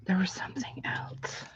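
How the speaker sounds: soft whisper while thinking; whisper to self. Real, not acted. Crafter trying to remember, whispering to herself. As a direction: murmur it to yourself, barely voiced.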